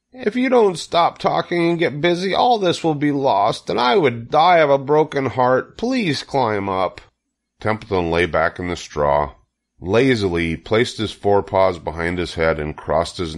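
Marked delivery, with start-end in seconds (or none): 0.1-7.0 high, impassioned piglet plea; desperate. A narrator's reading. Wilbur begs the rat to climb up, desperate. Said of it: "said Wilbur in desperation".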